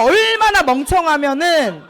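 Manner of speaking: loud, high retold insult; mock cursing. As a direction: imitate mom berating you. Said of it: "막 욕을 하면서".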